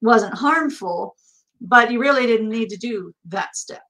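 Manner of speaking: usual speaking voice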